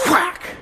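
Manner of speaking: sharp high whack sound effect; imitated slam noise, light; mock whack. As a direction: toss out a quick vocal whack. Imitating slammed divider sound.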